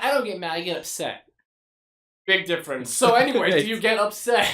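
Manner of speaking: loud, high, barely holding back laughter; mock indignant, laughing; loud amused quote. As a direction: punch it out while laughing. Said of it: "he said, like".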